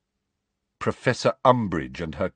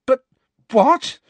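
high, loud outraged cry; teen protesting an unfair punishment; furious protest; 7 dB louder than usual. A narrator's reading. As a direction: burst out against the unfairness. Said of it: "furious at this injustice".

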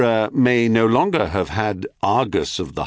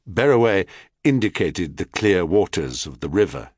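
ordinary delivery, nothing marked

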